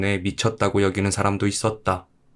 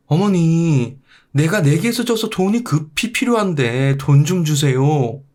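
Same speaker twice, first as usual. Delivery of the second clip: higher, urgent demand; pushy son; 7 semitones higher, slower than usual. Acted. Son rushing home to demand money from his mother, higher and urgent. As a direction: whine for money like a spoiled son.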